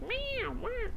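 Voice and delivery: very high-pitched cat meows; squeaky yowling meow, way up high; cat meow impression. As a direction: imitate a cranky, expressive cat. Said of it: "he's like".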